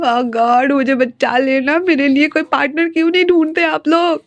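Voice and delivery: a hammed-up mock lament; whining inwardly. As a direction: plead to the heavens like a lonely third wheel. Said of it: "ऐसे वो रो रहा होता है अंदर ही अंदर".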